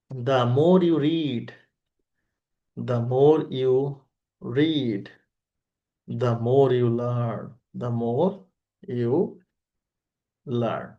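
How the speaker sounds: very slow, drawn-out example phrase; slow recitation. Shown as a demonstration. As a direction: recite it word by word, stretching each one. Reciting a familiar childhood textbook example. (ধীরে ধীরে টেনে টেনে আবৃত্তি)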